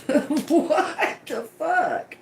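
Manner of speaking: high, slow laugh breaking into the words; laughing disbelief. Real, not acted. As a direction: laugh out the question in real disbelief. Laughing in disbelief at odd word.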